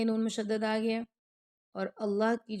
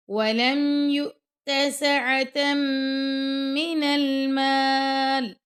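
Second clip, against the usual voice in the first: slow, measured tajweed chant; Quran recitation; 5 semitones higher, 8 dB louder, much slower than usual. Shown as a demonstration. Teacher reciting the verse to students, slow and melodic. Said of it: "اب یہاں پہ رکیں گے".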